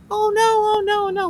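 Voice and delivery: alarmed. Retold or imitated. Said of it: "I was like".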